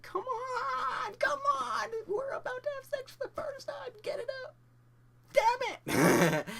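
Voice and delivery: very high, strained screechy pleading; hammed-up freak-out. Panicking first-timer urging himself on, high mock shouting. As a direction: beg your own body in squealing desperation.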